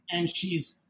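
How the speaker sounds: ordinary delivery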